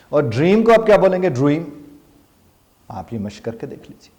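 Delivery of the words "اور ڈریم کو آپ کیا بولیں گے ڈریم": ڈریم with rounded lips. Shown as a demonstration. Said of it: "آپ کے lips round ہوں گے".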